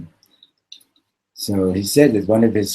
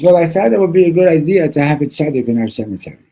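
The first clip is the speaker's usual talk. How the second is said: higher, louder, fast; rushed explanation; 7 semitones higher, 7 dB louder, faster than usual. Retold or imitated. Wife revealing her plan, fast and higher. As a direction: blurt the plan out quickly. Woman's voice retold.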